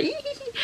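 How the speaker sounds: high squeaky giggle; happy giggle. Retold or imitated. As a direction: giggle high like a giddy cartoon. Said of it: "vous êtes là".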